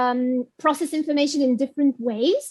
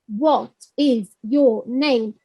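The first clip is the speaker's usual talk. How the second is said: slow, lower, each word on its own; greenhouse language; 4 semitones lower, much slower than usual. Shown as a demonstration. Teacher imitating controlled coursebook language, slow and separated. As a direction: say "What is your name" word by word, fully uncontracted. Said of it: "It's like in a greenhouse".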